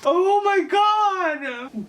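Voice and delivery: loud, high, long-drawn mock horror; mock-horrified exclamation. Author reacting to her own cliffhanger, hammed-up shock.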